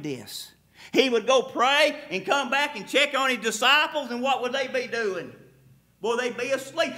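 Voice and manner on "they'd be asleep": ordinary delivery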